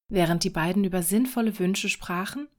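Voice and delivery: ordinary delivery, nothing marked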